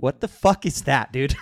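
loud, slow disbelief; outraged. Real, not acted. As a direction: blurt it out in outrage. Outraged at coach's comment.

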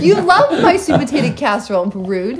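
high, laughing protest, dropping on the last word; mock offended. Wife defending her casserole to husband, mock offended. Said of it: "Officially offended".

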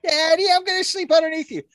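put-on very high, little squeaky child voice; baby spider voice. Spider child talking to its human daddy, squeaky pretend voice. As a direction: pipe it up high like a tiny creature.

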